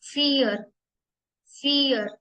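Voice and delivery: फियर said "fear" with the r dropped; फियर repeated clearly; German vier. Shown as a demonstration. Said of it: "फ़ोर".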